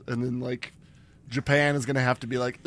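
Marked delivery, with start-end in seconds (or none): none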